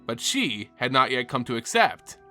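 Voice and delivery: ordinary delivery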